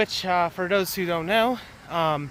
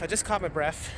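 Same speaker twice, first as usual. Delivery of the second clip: ordinary talk, nothing marked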